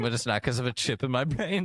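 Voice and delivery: normal talking voice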